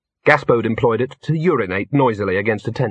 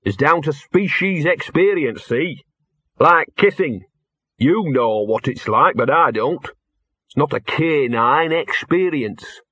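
higher-pitched, streetwise mutt character; put-upon dog voice; grumbling talking dog; 5 semitones higher than usual. Acted. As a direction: explain grudgingly to slow-witted humans.

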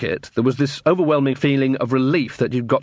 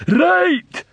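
excited troll shout; 5 dB louder than usual. A narrator's reading. Troll triumphant.

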